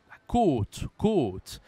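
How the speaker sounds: côte with a long closed O, punched; circumflex ô. Shown as a demonstration. Teacher shows what the circumflex does, emphatic closed O in côte. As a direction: stretch the rounded, closed O in côte. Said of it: "c'est grâce à l'accent circonflexe".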